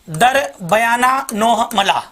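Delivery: slow, spaced-out recitation of a list; slow drilled mnemonic. Shown as a demonstration. Teacher reciting a memory trick slowly to students. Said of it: "समझ गए क्या?".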